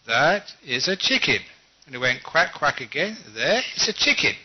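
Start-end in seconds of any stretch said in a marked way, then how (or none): 0.1-1.4 firm, emphatic husband contradicting his wife; mock-firm correction. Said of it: "very firmly he said". 3.3-4.4 emphatic husband pointing out proof; mock-firm insistence. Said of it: "very firmly he said".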